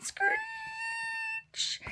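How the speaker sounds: very high squealing screech of a trumpet. Acted. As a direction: squeal it like a badly blown trumpet. Trumpet noise imitated.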